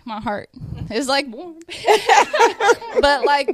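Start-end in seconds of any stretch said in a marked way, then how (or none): 0.9-1.6 laughing through the words. Real, not acted. Joyful laugh while describing warmth. 1.6-3.0 joyful burst of laughter; high bright laugh. Real, not acted. Laugh after her heart warms.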